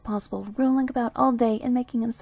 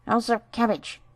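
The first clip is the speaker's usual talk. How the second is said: mouthful; 2 semitones higher than usual. A narrator's reading. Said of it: "answered Whitey with a mouthful".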